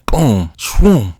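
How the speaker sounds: loud, high boom and whoosh; punched, swooping explosion noises; imitated explosion. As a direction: voice the blast as comic effects. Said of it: "an explosion happens".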